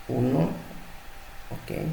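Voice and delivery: ordinary delivery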